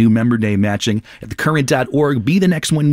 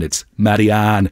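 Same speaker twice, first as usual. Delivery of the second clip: slow Irish Dublin accent; slower than usual. Shown as a demonstration. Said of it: "you don't do it in the Dublin accent".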